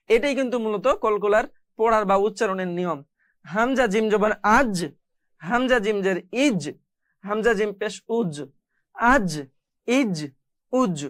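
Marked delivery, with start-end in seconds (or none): 3.5-11.0 final j in "আজ", "ইজ", "উজ" bounced; qalqalah echo. Shown as a demonstration. Teacher drilling students, echoed j ending "আজ, ইজ, উজ". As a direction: release each j of "আজ, ইজ, উজ" with a short echo. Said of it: "এভাবে করে কলকলার হরফগুলো কিন্তু উচ্চারণ করতে হয়".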